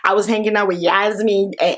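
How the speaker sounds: ordinary talk, nothing marked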